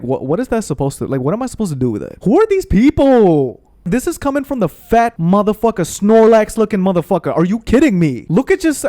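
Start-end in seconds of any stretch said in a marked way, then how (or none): 2.2-3.6 slow, drawn-out high shout of disbelief; outraged. Real, not acted. Outraged rant about insulting friends. 3.8-8.8 indignant rant. Real, not acted. Outraged rant about insulting friends.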